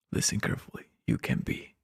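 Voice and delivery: hushed mock hypnotic whisper. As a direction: whisper it like a suggestion.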